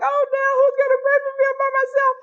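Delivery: high, thin, self-pitying wail; acting out a tearful lament; mock wailing. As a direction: lament it in a sustained high whine. Retold lament over praying mom.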